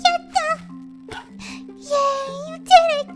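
high, loud burst of joy; gleeful cartoon victory cheer; excited cheering. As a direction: celebrate with a squeal. Cheering the puppy's success.

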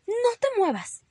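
high, urgent warning; alarmed mother ordering stillness. Acted. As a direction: stop the child in his tracks. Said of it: "le advirtió mamá".

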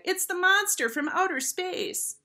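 high-pitched schoolboy cry; screamed taunt. A narrator's reading. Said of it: "screamed a chubby fourth grade boy".

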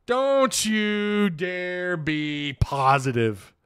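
slow mock warning. Streamer teases viewer Camo, slow drawn-out warning. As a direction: stretch every word into a teasing warning.

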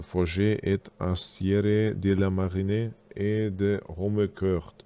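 slow, halting French with a German accent; broken french. Real, not acted. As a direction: stumble slowly through the French name. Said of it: "entschuldigt mein Französisch".

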